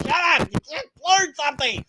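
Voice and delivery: loud hammed-up rant; mock rant. Irritated atheist blowing up at creationists, hammed-up shouting. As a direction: yell at the viewers to read a book. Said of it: "it's like".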